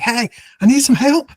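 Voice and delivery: high, loud call down the hall; an urgent, high call for help down the corridor. Retold or imitated. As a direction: shout for help from the room. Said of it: "she yells out in the hall".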